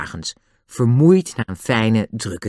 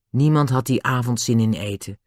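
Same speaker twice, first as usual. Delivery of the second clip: usual speaking voice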